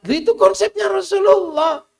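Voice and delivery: loud, high declaration; emphatic shout. Real, not acted. Preacher proclaiming the Prophet's concept to the crowd, loudly. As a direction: proclaim it with force.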